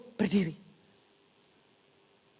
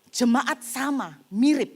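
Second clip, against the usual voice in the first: ordinary talk, nothing marked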